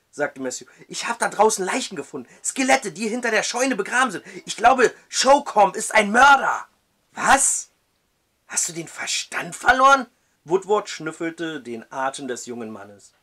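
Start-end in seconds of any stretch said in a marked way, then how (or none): 0.9-6.7 fast, high, loud and alarmed; frightened report. Acted. Matthew tells the judge about the skeletons, alarmed. 7.1-10.1 high, loud incredulous; can't believe his ears; disbelief. Acted. The judge doubts Matthew's sanity, incredulous.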